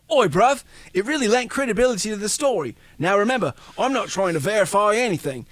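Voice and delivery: a lightly hammed London accent; mock British accent. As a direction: play Bencher as a cheeky London bloke. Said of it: "It's my terrible German accent".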